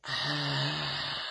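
soft, low, airy "ah"; long, relaxed breath out; soft open sigh. Shown as a demonstration. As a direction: let the throat fall open on a quiet exhale. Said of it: "And we learn to".

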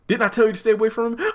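high, fast, sharp put-on voice; mocking Jasmine. Jasmine confronting Andy, high and fast. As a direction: snap the question at him.

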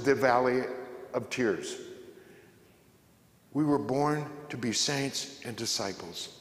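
ordinary talk, nothing marked

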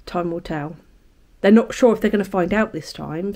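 ordinary talk

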